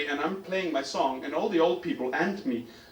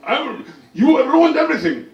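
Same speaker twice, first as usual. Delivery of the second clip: hammed-up high, furious yell; loud, raging old-man accusation; old man screaming; 8 semitones higher, 11 dB louder than usual. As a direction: shout them down in outrage. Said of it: "when people scream at me like that".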